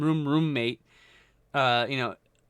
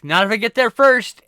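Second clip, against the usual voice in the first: loud, higher taunt shouted back; mock shout; 7 semitones higher, 8 dB louder than usual. Drunk roommate shouting at the door. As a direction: yell it like racing for the toilet. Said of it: "someone's like".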